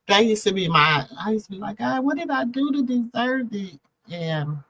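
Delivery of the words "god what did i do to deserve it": high, softened cry asking God why; anguished plea to God. Retold or imitated. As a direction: beg the Lord, voice lifted high. Retells crying out to God in pain.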